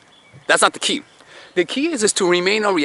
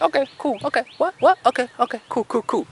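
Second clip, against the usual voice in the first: higher, breezy dismissive okays; mock unbothered; 9 semitones higher than usual. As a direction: shrug it all off like nothing lands. Chilling while he swings, unbothered.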